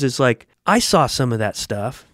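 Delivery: usual speaking voice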